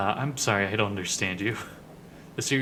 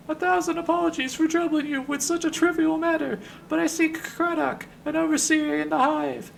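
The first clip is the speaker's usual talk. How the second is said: high falsetto, courteous and formal; Ben the Sender. Acted. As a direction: plead politely, way up in falsetto. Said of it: "in a high voice".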